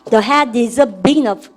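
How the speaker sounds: very slow, word by word; slow drill read. Shown as a demonstration. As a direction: model it slowly for repetition. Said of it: "시작". (천천히 따라 읽기 시범)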